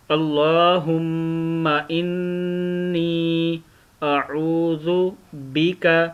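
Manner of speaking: slow recitation with long held vowels; Quranic recitation. Shown as a demonstration. Teacher modelling the kalma for learners, slow recitation. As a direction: recite phrase by phrase, drawing out the vowels.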